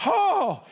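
high, forceful "oh" as a crowd; mock crowd outcry; imitated crowd groan. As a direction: react like a dismayed ballpark crowd. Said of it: "And the crowd went".

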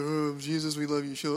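lifeless congregational drone, imitated; mock half-hearted prayer response in a monotone; mock funereal mumble. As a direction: mimic an unconvincing, dreary group reading. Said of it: "it sounds like a dog's funeral".

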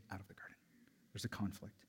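dramatic hushed whisper. Acted. Pastor whispering to congregation about conflict, hushed. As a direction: drop to a whisper for effect.

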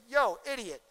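a hammed-up rude rebuke; rude insult. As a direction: sneer at someone like a fool would. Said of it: "You don't turn around and say".

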